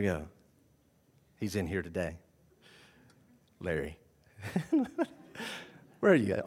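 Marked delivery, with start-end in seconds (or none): none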